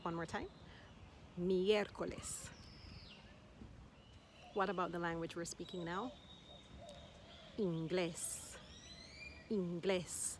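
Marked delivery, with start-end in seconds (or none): 1.4-2.5 "Miércoles" said slowly but joined up; one careful, unbroken take of "Miércoles"; slow whole word. Shown as a demonstration. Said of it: "take your time to break it down". 7.6-10.4 "Inglés" stressed on its final "glés"; last-syllable stress. Shown as a demonstration. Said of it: "emphasis is on the last syllable".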